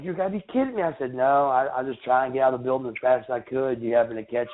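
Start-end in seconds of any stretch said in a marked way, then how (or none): 0.0-0.8 higher retold disbelief; pitched-up stunned reaction; mild imitation of a shocked friend. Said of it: "and he's like".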